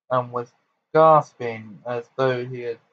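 ordinary delivery, nothing marked

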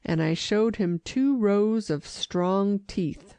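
usual speaking voice

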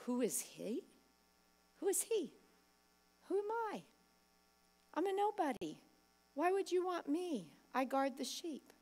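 soft, small voice of doubt; timid self-doubt. Retold or imitated. A preacher voicing a doubting shepherd boy, soft and small. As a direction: shrink into yourself, unsure.

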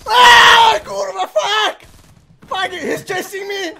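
panicked high yelling while fleeing; real frantic shout, half laughing; being chased. Real, not acted. Fuck it, he's chasing me.

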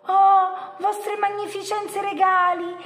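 high, loud eager creature voice; hopeful yellow monster. A narrator's reading. Yellow monster begs two monsters to promise, hopeful and high. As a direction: plead upward with fawning hope. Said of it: "chiese il mostro giallo".

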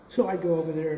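ordinary talk

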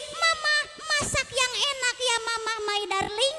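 sweetly begging the wife for good food; sweet-talking husband. Retold or imitated. Husband coaxing his wife for a good meal, high and soft. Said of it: "Minta ke istri".

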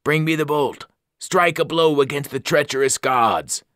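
an evil thing urging you on; hungry ancient voice. Acted. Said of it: "The voice became hungrier".

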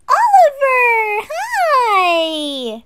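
high, loud delighted call; overjoyed greeting at the door; excited gasp greeting. Acted. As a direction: gasp and call his name, thrilled. Excited to see Oliver arrive.

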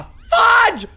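high, loud frightened yells; panicked shouting. Real, not acted. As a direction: scream it as she closes in. Chased by Granny in horror game.

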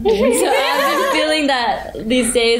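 laughing through the words; chuckling as she talks. Real, not acted. Laughing while speaking.

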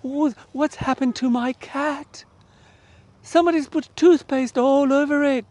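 high-pitched alarmed little sister; upset sister. Acted. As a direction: squeal it out like a girl finding her cat ruined. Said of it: "came screaming around the corner".

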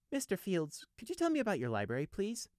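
high, sweetly polite request; pleasant. A narrator's reading. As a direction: ask ever so nicely, needling. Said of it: "Prey added pleasantly".

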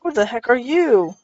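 ordinary delivery

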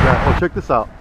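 ordinary talk, nothing marked

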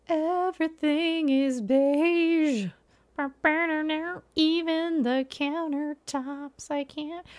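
mock-tragic lament over beige; dramatic despair. Real, not acted. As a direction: draw it out in theatrical anguish. Dramatic outrage at decor.

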